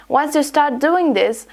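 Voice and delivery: usual speaking voice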